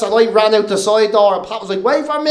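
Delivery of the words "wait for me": high-pitched retold plea; mock calling out. Guitarist fleeing after the comedian, high mock call. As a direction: imitate a pal calling after you. Bandmate calling as they flee.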